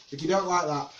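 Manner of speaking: ordinary delivery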